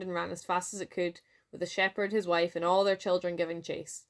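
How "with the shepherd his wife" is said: usual speaking voice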